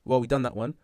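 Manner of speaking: ordinary delivery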